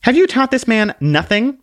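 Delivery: high, polite little lady; curtsy it out sweetly, voice up high; prim little book voice. Acted. Book introduces herself to the man with a high dainty bow.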